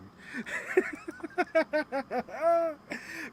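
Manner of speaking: long, high peal of giggles; real laugh. Real, not acted. Host delighted by an actress's impression, laughing. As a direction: keep laughing helplessly for a few seconds.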